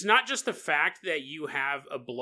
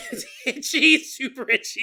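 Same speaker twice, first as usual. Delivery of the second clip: laughing; 10 semitones higher, 5 dB louder than usual. Real, not acted.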